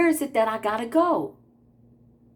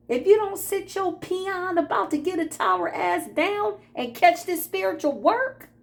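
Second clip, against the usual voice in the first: higher, sassy telling-off; sassy scolding; 10 semitones higher than usual. Acted. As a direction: put the haters in their place, higher. Scolding petty haters, sassy.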